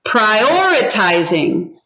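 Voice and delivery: "prioritizing" slowly, OR pushed up in pitch; stressed second syllable. Shown as a demonstration. Teacher asks class to hear the accent, "prioritizing" stressed on OR. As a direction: lean on the OR in "prioritizing". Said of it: "now listen for the accent".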